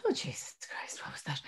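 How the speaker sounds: whispered, mortified aside; horrified whisper. Retold or imitated. Mother mortified by her son's scream, whispering. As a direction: hiss it under your breath in shock.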